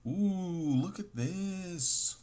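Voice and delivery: high, thrilled ooh, look at this; excited ooh. Real, not acted. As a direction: gasp with delight at the card. Excited by a pulled card.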